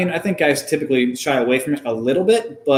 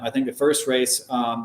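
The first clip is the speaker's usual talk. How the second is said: ordinary talk, nothing marked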